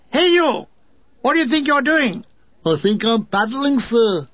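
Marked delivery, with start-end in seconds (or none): 0.1-2.2 irate shout. Acted. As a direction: call out sharply to the paddling gunner. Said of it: "An irate officer shouts at him".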